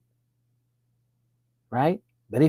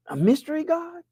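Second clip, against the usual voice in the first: mocking high question; 12 semitones higher, 4 dB quieter than usual. Real, not acted. Sarcastic rhetorical question, repeated.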